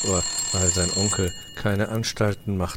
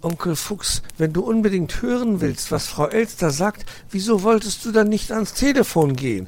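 put-on high, louder puzzled boy; cheeky young fox; 12 semitones higher, 5 dB louder than usual. Nephew questions grumpy uncle, high boy voice.

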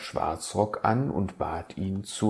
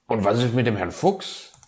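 a touch louder and quick, eager; excited fox; 4 semitones higher, 6 dB louder, faster than usual. Acted. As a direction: blurt out the question. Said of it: "war der Fuchs gleich aufgeregt".

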